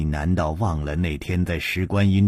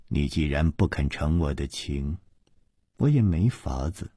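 low, soft, cool dismissal; understated chilly scorn; cold sneer; 6 semitones lower, 5 dB quieter than usual. A narrator's reading. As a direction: brush it off coldly, voice kept low. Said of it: "柳无梅冷笑着说".

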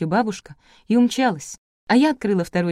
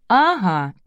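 ordinary delivery, nothing marked

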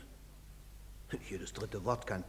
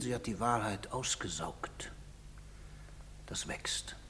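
hushed unvoiced whisper; 4 semitones lower, 12 dB quieter than usual. A guilty father confesses his lie to himself, whispering. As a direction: breathe it out as a dark secret.

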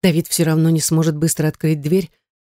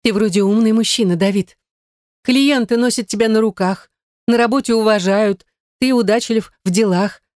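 lightly voiced Gaine; 5 semitones higher than usual. A narrator's reading. Gaine confessing love.

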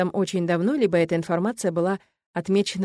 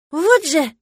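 loud, high exasperated outburst; exclamation; 8 semitones higher, 8 dB louder than usual. A narrator's reading. Witch exclaims as the dog runs off, exasperated. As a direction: blurt it out in annoyance. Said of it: "воскликнула я".

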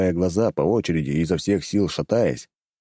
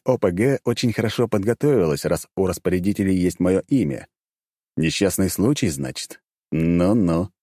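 plain reading voice

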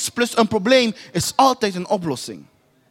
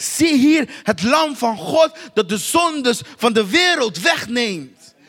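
1 proclaiming; 3 dB louder, slower than usual. Acted. John proclaims the Lamb.

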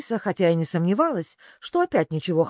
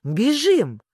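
urgent call; 6 semitones higher, 4 dB louder than usual. A narrator's reading. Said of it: "только повторил".

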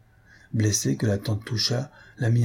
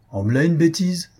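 higher-pitched indignant exclamation; exclaiming; 7 semitones higher than usual. A narrator's reading. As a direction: cry out, mildly scornful, pitch raised. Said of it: "s'écria la tante Toucha".

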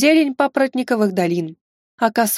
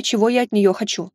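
plain reading voice

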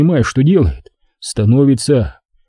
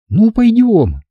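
put-on high, girlish "пойдём"; girl's consent; 9 semitones higher, slower than usual. A narrator's reading. Girl echoes him in her voice.

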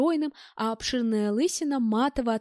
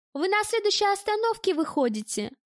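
high, piping spiteful squeak; 9 semitones higher than usual. A narrator's reading. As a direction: pipe it up with sadistic sweetness. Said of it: "громко пропищала дамочка".

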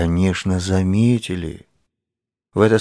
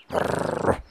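low breathy doggy growl; 12 semitones lower, 8 dB quieter than usual. Acted. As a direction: growl like a playful dog. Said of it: "гавкнула".

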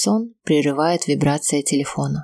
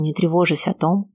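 ordinary delivery

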